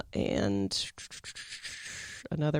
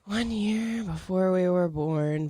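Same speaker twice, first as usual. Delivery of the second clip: slow, higher-pitched echo; drawn-out echo; 4 semitones higher, slower than usual. Real, not acted. Echoing the co-host on one year before they were born, drawn out. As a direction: repeat it slowly, stretching the words. Echoing cohost's remark in wonder.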